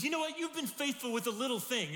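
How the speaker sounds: high, loud, radical praise; excited master. Retold or imitated. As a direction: surprise him with big news. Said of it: "He says".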